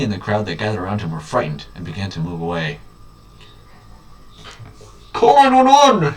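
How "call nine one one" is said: high, loud, slow panicked call; shouted. Acted. Bystander in the crowd shouting for help, high and loud. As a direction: yell for help over the crowd. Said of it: "shouted a brave soul in the crowd".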